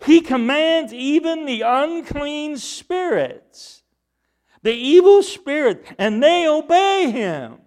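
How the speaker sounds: loud, high-pitched astonishment; amazed crowd. Acted. Amazed crowd reacting to Jesus's authority, loud astonishment.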